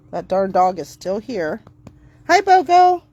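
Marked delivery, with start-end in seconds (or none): 2.3-3.0 high, loud and cheery; bright, welcoming hello to a dog; upbeat greeting. Real, not acted. Greeting the new dog Bogo, high and cheery.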